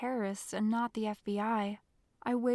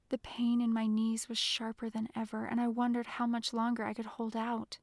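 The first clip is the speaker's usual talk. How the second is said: ordinary delivery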